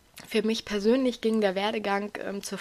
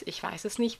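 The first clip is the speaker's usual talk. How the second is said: ordinary talk, nothing marked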